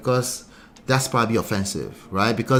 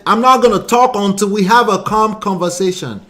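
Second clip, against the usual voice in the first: higher, louder mock quote of him; 7 semitones higher, 7 dB louder than usual. Host mimicking the man refusing to talk, raised voice. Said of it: "he said".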